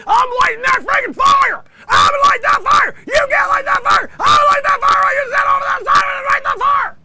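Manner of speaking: very high, loud screaming rant; Sam Kinison. Retold or imitated. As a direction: scream it like a manic stand-up. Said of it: "I could do an impression of him".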